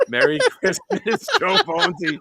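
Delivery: high-pitched, loud laughing words; cracking up. Real, not acted. Host reading chat comments, cracking up. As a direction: laugh through the sign-off.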